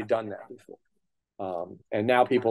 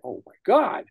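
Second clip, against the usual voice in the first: awestruck; 7 semitones higher than usual. Retold or imitated. Awed at hearing the creators.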